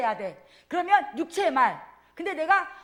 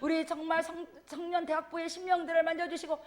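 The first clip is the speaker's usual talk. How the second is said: soft, hushed morning prayer, a bit quicker; 5 dB quieter than usual. Retold or imitated. Deacon's dawn prayer recited to the congregation, soft prayer. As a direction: pray it quietly as at dawn. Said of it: "마음의 기도도 내가".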